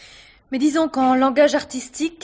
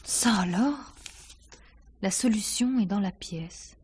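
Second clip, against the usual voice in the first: slow, soft whispered repeat of the note; stunned whisper; 5 dB quieter, slower than usual. Acted. Actress to the inspector, rereading the note in a stunned whisper. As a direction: breathe the words as if they were a secret.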